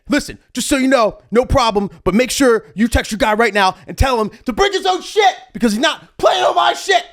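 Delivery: high, loud defiant warning. Retold or imitated. DJ warning the promoter on his way out, high defiant tone.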